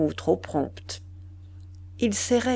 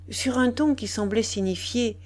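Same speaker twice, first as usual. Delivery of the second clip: plain reading voice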